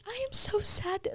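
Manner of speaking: high, soft, whiny imitation of herself; mock sad whimper. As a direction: pitch it up and whimper it quietly. Retold call to husband postpartum.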